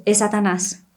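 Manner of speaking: ordinary delivery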